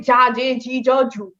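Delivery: "যা, জে, জি, জ, জু" with English j, not dz; mistaken J sound. Shown as a demonstration. Teacher showing a completely wrong Zeta, mistaken J sound. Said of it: "যেটা completely রং".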